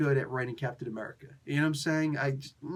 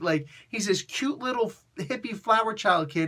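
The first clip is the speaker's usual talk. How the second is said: normal talking voice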